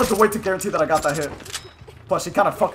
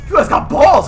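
loud, high burst; excited shout; 9 semitones higher, 10 dB louder than usual. Real, not acted. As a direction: yell it in disbelief. Excited reaction to bold opponents.

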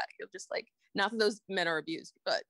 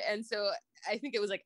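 usual speaking voice

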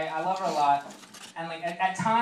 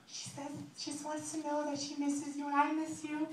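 high, soft, sustained whimper; mock crying girl; 10 semitones higher, 8 dB quieter than usual. One-night stand passing on mom's message, mock crying. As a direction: pitch it way up, hammed-up tearful. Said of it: "pretty much my, my crying. And she's like".

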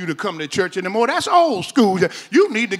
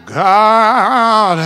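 usual speaking voice

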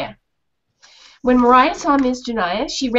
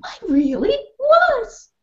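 very high, slow pleased voice; proud dog; 12 semitones higher, slower than usual. Acted. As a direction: savour it proudly.

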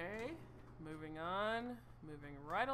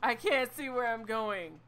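frustrated louder; 5 semitones higher, 11 dB louder than usual. Real, not acted.

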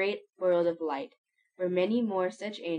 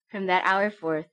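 plain reading voice

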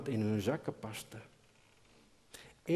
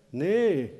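a louder sharp no, called down; 5 semitones higher, 10 dB louder than usual. Acted. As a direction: shout the refusal again. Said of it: "riep Benjamin weer". (luid geroepen nee)

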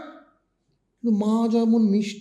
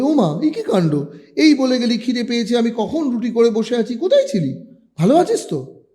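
higher, louder fretting mother; exasperated mother; 5 semitones higher, 5 dB louder than usual. A narrator's reading. Mother scolding her returning son, fretful and loud. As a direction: fuss over the late son.

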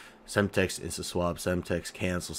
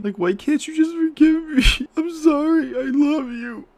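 very high, whiny begging voice with laughs; whiny high plea; 5 dB louder than usual. Acted. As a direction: beg for forgiveness like a cartoon kid. High pleading cute voice.

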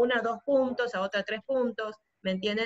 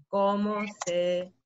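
drawn-out dictation; 4 semitones lower, much slower than usual. Shown as a demonstration. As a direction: stretch the words as if typing, then trail away. Sample answer said while typing.

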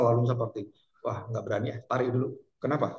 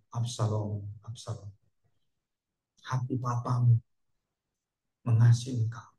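mild grieving father; 4 semitones lower, 5 dB quieter than usual. Retold or imitated. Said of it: "Daud langsung nangis".

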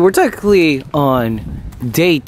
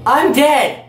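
calling out; 5 semitones higher, much slower than usual. Real, not acted. Calls out during play.

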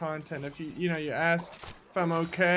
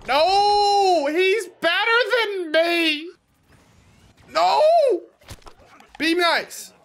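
much higher, loud flustered protest; high mock protest; 11 semitones higher, 7 dB louder, much slower than usual. Streamer squeakily protesting a chat compliment. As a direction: wave off the praise in a squeaky voice.

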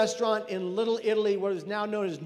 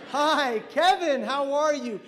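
high, loud, drawn-out "hi"; overdone greeting; 5 semitones higher, 6 dB louder, slower than usual. Retold or imitated. Margaret greets Kevin from the psych ward, with overdone enthusiasm. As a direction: greet him high, loud and far too brightly. Margaret's reaction to recognizing the caller.

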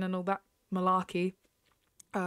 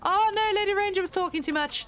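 high, loud oh no of dismay; dismayed outcry; 9 semitones higher, 4 dB louder than usual. Real, not acted. Gamer watching her horse lose, dismayed high cry. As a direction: wail at losing the race.

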